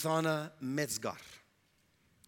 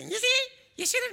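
much higher, hammed-up told-you-so; mock scolding husband; 6 dB louder than usual. Husband mockingly scolds his wife, hammed-up and high. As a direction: squeak out a mock reproach. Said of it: "he didn't say".

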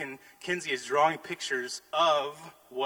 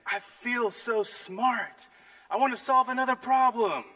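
put-on high, thrilled child's voice, a bit louder; excited little girl; 10 semitones higher, 4 dB louder than usual. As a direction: bounce it like a proud kid. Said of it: "started jumping up and down".

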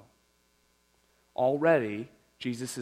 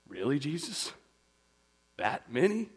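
Peter dismayed; 4 semitones higher than usual. Retold or imitated. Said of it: "I can almost see it on Peter's face".

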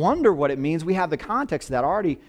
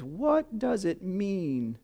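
slow, softer, awestruck question; tearful wonder; 4 semitones higher, 6 dB quieter, much slower than usual. Retold or imitated. Pastor retelling the crying rainbow man's question, slow and hushed. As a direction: ask it slowly as if choked with tears. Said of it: "and he says".

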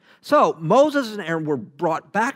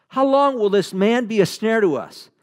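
higher, louder servants' protest; exasperated demand; 6 semitones higher, 5 dB louder than usual. Acted. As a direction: press Pharaoh with an exasperated plea. Acting out servants confronting Pharaoh.

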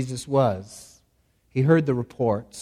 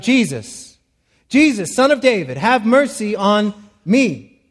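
crying out; 5 semitones higher, 5 dB louder than usual. Shown as a demonstration. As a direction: cry out to Jesus, begging loudly. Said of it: "So he cries out".

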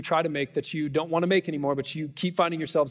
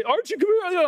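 much higher, louder call after a runaway dog; 14 semitones higher, 4 dB louder than usual. Retold or imitated. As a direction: shout for the escaped dog across the field. Chasing loose dog, calling out.

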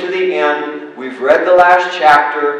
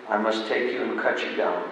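grave and softer; master's grave, soft verdict; 5 semitones lower, 10 dB quieter than usual. Acted. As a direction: deliver the sentence gravely, softly. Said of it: "voice was grave".

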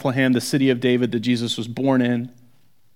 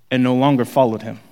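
ordinary delivery

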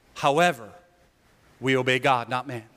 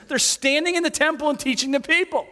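whiny high priest voice; 10 semitones higher than usual. Acted.

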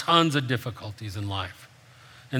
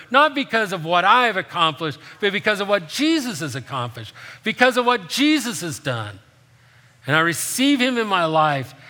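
preaching higher and louder, building; impassioned preaching; 7 semitones higher, 4 dB louder than usual. Acted. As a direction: lift the church with conviction. Preaching builds to full force.

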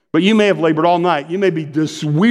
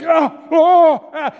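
put-on high, loud childish oh-oh-ah; excited gasps; 13 semitones higher, 4 dB louder, much slower than usual. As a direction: kid straining against a big fish. Child fighting the fish.

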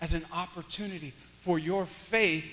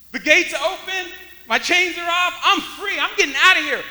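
loud, high, driving sermon delivery; full-force preaching; 9 semitones higher, 11 dB louder than usual. Real, not acted. Preacher telling how prisoners stayed captivated, full-force preaching. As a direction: preach it loud and urgent to the room.

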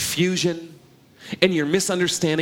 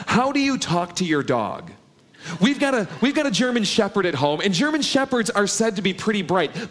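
ordinary talk, nothing marked

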